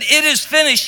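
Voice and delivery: triumphant. Acted. Preacher to the congregation, ringing out the cry as triumph. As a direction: proclaim it as victory. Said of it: "exact opposite".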